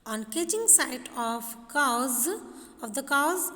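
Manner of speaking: slow, deliberate textbook story reading; measured classroom reading with slow, separated phrases; lesson read-aloud. Acted.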